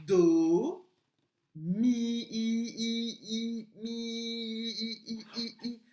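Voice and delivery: loud drawn-out "do", then "mi" held high with the "i" repeated; stretched syllables. Shown as a demonstration. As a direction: hold the vowel of "mi" and pulse it as "i, i, i". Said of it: "écoute bien".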